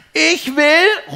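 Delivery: sehr hoher, lauter Kinderruf; rufendes Kind. A narrator's reading. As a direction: ruf fordernd und gedehnt wie ein Kind. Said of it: "ruft Pin". (lautes, forderndes Kinderrufen)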